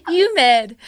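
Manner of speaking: ordinary delivery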